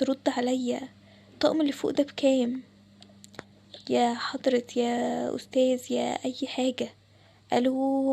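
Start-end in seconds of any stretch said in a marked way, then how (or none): 3.9-8.1 slow drawn-out calling. Acted. To an ignoring seller, drawn-out calls. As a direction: stretch each call out slowly, fed up.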